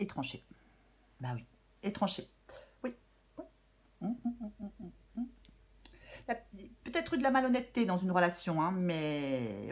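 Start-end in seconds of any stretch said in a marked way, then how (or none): none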